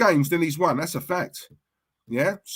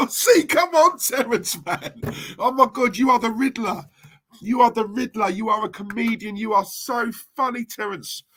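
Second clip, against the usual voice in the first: amused ridicule; 5 semitones higher than usual. Real, not acted. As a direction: exclaim in amused disbelief, pitched up. Ridiculing Terence after laughing.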